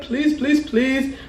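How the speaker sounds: imitating tearful pleading; boohoo begging. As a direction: plead in little pretend sobs. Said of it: "I'm boohoo crying".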